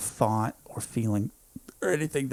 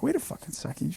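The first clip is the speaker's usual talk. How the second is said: sudden realization; 7 semitones higher, 7 dB quieter than usual. Retold or imitated. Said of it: "And I'm like".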